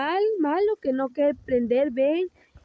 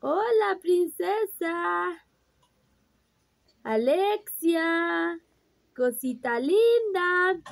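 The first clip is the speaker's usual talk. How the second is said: put-on high, slow, sing-song hello for the baby to copy; mother showing her baby how to say hello, cooing; baby talk greeting; 6 semitones higher, much slower than usual. Shown as a demonstration. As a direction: model the greeting sweetly for the baby.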